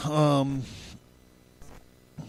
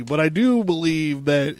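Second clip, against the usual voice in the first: normal talking voice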